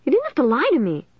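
high-pitched, hurt reproach; shrill. A narrator's reading. Margaret confronts Joanna over the lie, shrill. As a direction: accuse a friend shrilly. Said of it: "Margaret interrupted shrilly".

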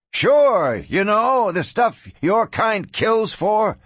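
high, drawn-out taunt; sneering. Acted. Rancher offers the gunman money, sneering taunt. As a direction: rub it in scornfully.

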